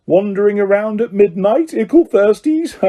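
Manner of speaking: high mocking cackling taunt; cackling poltergeist. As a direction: tease them like a gleeful poltergeist. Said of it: "Peeves cackled".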